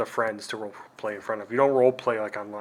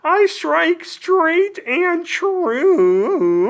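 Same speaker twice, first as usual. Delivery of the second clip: very high, louder, extremely slow heroic proclamation; noble elf lord; 7 dB louder, much slower than usual. Acted. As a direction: declaim each word slowly like a lofty elf hero. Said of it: "time for Elf Lord McBent Sword".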